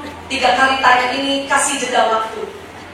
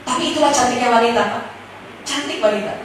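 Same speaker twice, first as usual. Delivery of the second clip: ordinary talk, nothing marked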